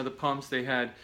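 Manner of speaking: normal talking voice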